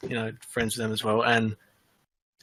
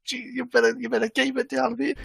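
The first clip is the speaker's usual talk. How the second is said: retold warning in a much higher, amused voice; laughing warning; 13 semitones higher than usual. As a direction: imitate friends trying to hush him through amusement. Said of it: "we're laughing, but at the same time".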